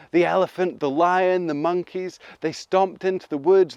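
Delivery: ordinary delivery, nothing marked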